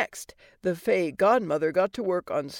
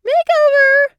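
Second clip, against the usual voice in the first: shrill, loud fangirl scream; 13 dB louder than usual. A narrator's reading. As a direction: scream it like an excited teen fan. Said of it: "teens to scream".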